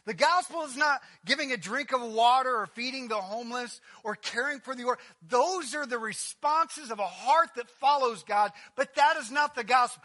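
loud, building sermon voice; full-force preaching. Real, not acted. Preacher proclaiming the gospel to the congregation, loud.

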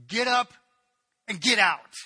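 loud, cold barked command; furious dismissal. Acted. Amnon drives Tamar out of his room, furious shout. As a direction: throw her out with contempt.

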